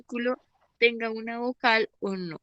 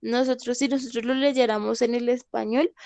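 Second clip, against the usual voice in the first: normal talking voice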